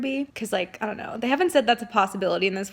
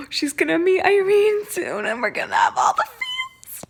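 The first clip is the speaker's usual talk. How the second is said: excited squeal; 9 semitones higher, 5 dB louder than usual. Real, not acted. Excited reader anticipating a reunion.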